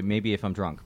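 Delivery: ordinary talk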